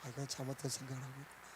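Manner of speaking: quick, low aside to oneself; very soft, thinking aloud; dawning realization. Acted. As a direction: quietly own up to a mistake. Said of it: "깨달아진다는 거예요".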